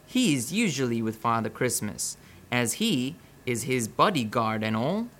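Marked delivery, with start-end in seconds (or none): none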